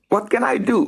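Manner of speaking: high, anxious; worried boy. Acted. Sam asking trapped Rory what he can do. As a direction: ask eagerly.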